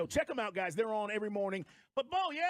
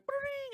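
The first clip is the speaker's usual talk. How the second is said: phone ring; 14 semitones higher than usual. Retold or imitated. Imitating the phone ringing.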